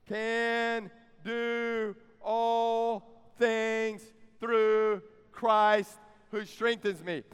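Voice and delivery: very slow, word by word, each one stretched; leading group recitation. Shown as a demonstration. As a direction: draw out every word so the congregation can join in. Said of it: "Say it with me".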